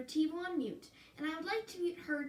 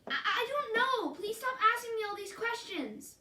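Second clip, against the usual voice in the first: higher, louder cry of frustration; upset outburst; 7 semitones higher, 7 dB louder than usual. Acted. Caitlin pushed too far by the counselor, shouting in frustration. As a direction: snap at her to stop, overwhelmed.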